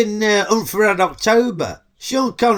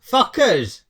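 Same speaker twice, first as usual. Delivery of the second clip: higher, repeated so she hears it; called out again; 6 semitones higher than usual. Real, not acted. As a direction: say it again for someone who missed it. Repeating word for someone who asked.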